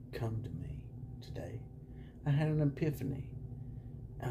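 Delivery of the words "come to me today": whispering. Acted. Whispered mystic announcement.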